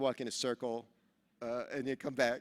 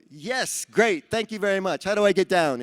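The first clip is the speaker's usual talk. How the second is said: louder, higher, eager to get down; loud eager reply; 6 semitones higher, 10 dB louder than usual. Retold or imitated. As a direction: call it out high and loud. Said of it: "and I go".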